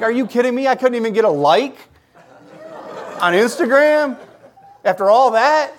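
loud, high-pitched indignant disbelief; mad outburst. Retold or imitated. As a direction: re-enact an exasperated rant at full volume. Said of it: "I was so mad".

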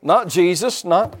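ordinary talk

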